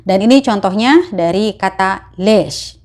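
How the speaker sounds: usual speaking voice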